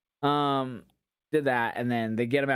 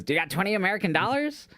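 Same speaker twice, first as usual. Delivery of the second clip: lightly voiced Danhausen; 8 semitones higher than usual. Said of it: "he goes".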